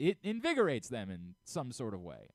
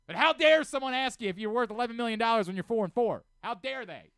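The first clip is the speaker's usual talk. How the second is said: sarcastic ranting; 10 semitones higher, 10 dB louder than usual. Real, not acted. Mock yelling at Dabo Swinney.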